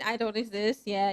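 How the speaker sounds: ordinary delivery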